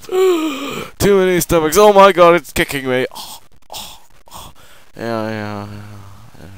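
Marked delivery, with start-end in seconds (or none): 0.1-3.1 gasping, much higher and louder, hammed-up panic; mock panic. Pretends to be the overfull snake. 3.1-4.0 gasp. Pretend. Mock panic continues.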